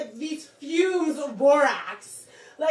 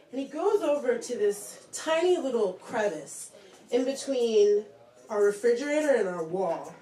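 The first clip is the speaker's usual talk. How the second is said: ordinary talk, nothing marked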